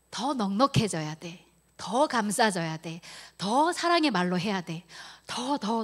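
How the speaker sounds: loud, slow, drawn-out urging; retold director's emphatic insistence; insistent demand. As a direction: press each demand hard, imitating a demanding boss.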